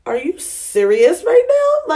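high, slow incredulous question; mock disbelief. To the man after five pumps, drawn-out disbelief. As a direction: let the disbelief ring out, drawn out. Quoting her own disbelief.

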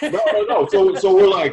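laughing through a high no no no; laughing protest. Real, not acted. Laughing off the friends' jokes about a lawsuit, laughing. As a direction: brush off the teasing with a laugh.

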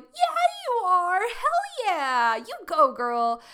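loud, high, whooping hype; cheering brain. Acted. Brain answering an energized affirmation, wildly cheering. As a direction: cheer them on at full volume, stretching the words. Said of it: "our brain's gonna go like".

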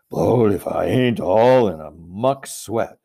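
puffing giant. Acted. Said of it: "puffing like the largest railroad engine".

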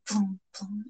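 hushed echo fading away; very quiet echoing aftersound. A narrator's reading. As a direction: softly repeat it like a dying echo. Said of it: "echo around the hall".

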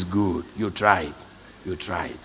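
low, slow, soft encouragement; gentle praise. Retold or imitated. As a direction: comfort them softly. Encouraging the apologiser.

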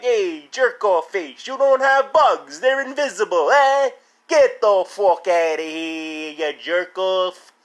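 high, slow, hammed-up Italian-American yell; imitated Staten Island tough guy. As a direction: drag out the insults in a mocking caricature. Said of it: "he kinda sounded like that".

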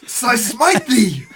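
slow, loud, high thundering prophet; mock biblical prophet. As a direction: smite them like an Old Testament prophet. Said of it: "he goes".

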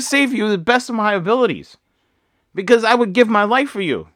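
impassioned parent explaining himself; worked up. Retold or imitated. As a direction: plead earnestly with your child, pitch lifted. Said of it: "getting choked up and worked up".

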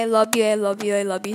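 obsessive lover. Retold or imitated. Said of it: "I sound like a psychopath".

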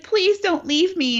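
high, slow pretend crying; mock tearful plea. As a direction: plead like a weepy partner, hammed-up. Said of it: "And she's, like, crying".

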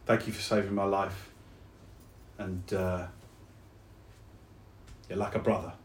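low, soft and tender; heartfelt thanks. Acted. Soldier hugs the friend who saved his life, tender. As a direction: say it quietly, with halting sincerity.

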